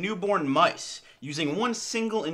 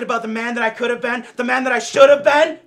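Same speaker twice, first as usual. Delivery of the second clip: much higher, much louder laughing wail; mock anguished shout; 6 semitones higher, 13 dB louder than usual. Comic regret over lost manhood, much louder hammed-up laughing wail.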